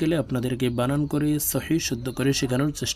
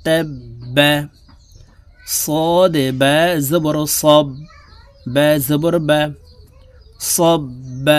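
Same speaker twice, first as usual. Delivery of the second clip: loud, slow teacher's spelling drill; 6 dB louder, much slower than usual. Shown as a demonstration. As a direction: spell each sound out loud for students. Spelling drill read aloud. (উচ্চস্বরে বানান করে পড়ানো)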